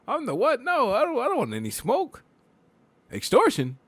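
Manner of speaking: high-pitched squeaky protest; mock alarmed refusal. Acting out refusing a shakedown.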